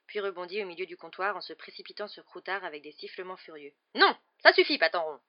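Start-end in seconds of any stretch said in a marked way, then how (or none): 3.9-5.2 loud, high scolding shout, dropping at the end; crying out. A narrator's reading. Witch to her cat attacking a rat, sharp shout. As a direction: shout to stop a misbehaving animal. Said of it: "s'écria la sorcière".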